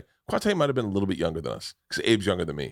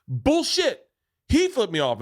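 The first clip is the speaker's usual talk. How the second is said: angry retort; 12 semitones higher, slower than usual. Retold or imitated. Road rager accusing the cousin.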